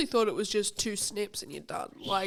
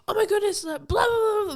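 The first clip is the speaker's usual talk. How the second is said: put-on high, loud excited child voice; excited kid; 12 semitones higher, 6 dB louder than usual. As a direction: squeal it like an eager kid. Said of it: "came up to me the other day and went".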